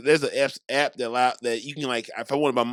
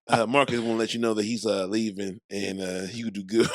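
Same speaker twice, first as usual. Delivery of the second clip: McConaughey impression; 8 semitones lower, faster than usual. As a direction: lightly mimic a laid-back star recording a message. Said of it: "Matthew McConaughey might be".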